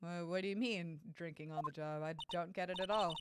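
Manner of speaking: lightly voiced puzzled investigator, thinking to himself; puzzled inner thought.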